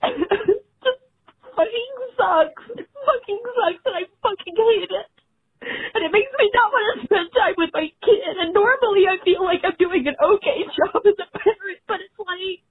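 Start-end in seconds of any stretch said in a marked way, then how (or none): 0.0-8.4 tearful, choked-up voice; crying. Real, not acted. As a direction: cry out the words. Caller crying.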